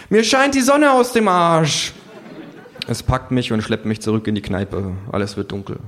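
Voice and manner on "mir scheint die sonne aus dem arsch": high, loud, slow retort; shrill, grumpy animal voice; sarcastic kangaroo. A narrator's reading.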